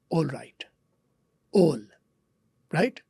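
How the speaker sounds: all right with a rounded British o, like "ol right"; British o sound. Shown as a demonstration. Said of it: "British वाले ए को ओ करके बोलते हैं".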